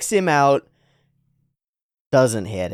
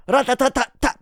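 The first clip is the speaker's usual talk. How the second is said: high rattling gun burst; imitated gunfire; 12 semitones higher, 3 dB louder than usual. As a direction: rattle off machine-gun fire. Imitating gunfire in manga action.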